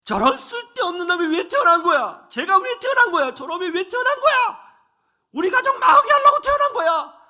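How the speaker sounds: put-on a drunk father raging at his son; furious shout. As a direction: bellow it with hatred. Abusive father yelling at son.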